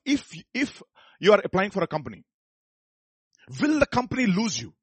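high, loud preaching, pressing each question; rising preaching. Acted. Pastor challenging the listeners, high and loud preaching. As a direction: press the point home to the church.

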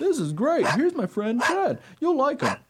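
very high, loud, happy dog voice; delighted dog character. Acted. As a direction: introduce a friend as an eager dog. Dog introduces his friend.

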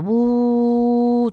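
imitating the constant buzz in the bathroom; humming imitation. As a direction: mimic the droning noise. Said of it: "He escuchado todo el rato".

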